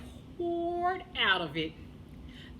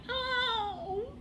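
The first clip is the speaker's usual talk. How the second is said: put-on high happy baby gasp; delighted baby; 11 semitones higher than usual.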